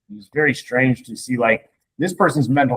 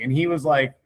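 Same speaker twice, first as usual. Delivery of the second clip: ordinary talk, nothing marked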